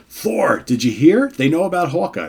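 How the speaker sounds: high, urgent priestess voice; alarmed woman calling to Thor; Moondragon voice. Acted. Moondragon alerting Thor, high and urgent. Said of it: "That's Moondragon".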